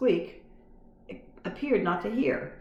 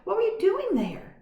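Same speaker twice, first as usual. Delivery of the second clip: put-on curious boy; 4 dB louder than usual. A narrator's reading. Garf voiced.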